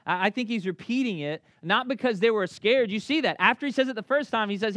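ordinary talk

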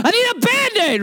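dad mimicking his son's whine, very high; whiny child. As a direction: mimic the kid's wail back at him. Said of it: "And I was like".

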